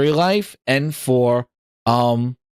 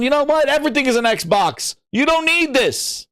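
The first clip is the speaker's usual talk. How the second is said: mock developer quote; 6 semitones higher than usual. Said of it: "tells you at the end going".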